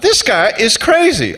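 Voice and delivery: incredulous. Retold or imitated. Climbers' thought at the steep drop.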